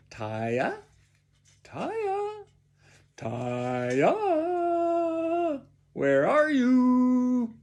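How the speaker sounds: searching sing-song call, rising and swooping. A narrator's reading. Father looking for his daughter, calling out. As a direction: call the name, growing to a long, high holler.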